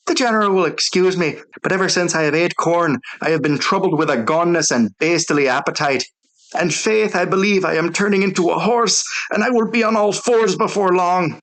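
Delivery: high-pitched Irish brogue, rambling and earnest. A narrator's reading. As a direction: plead in a broad Irish brogue.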